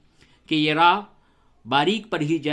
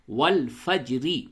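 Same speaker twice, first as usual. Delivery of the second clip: ordinary talk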